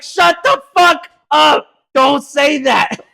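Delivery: high, loud, slow yell; retold furious shout. Silencing the kid's kidnapping cry, mock-furious shout. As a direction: yell each word to silence him.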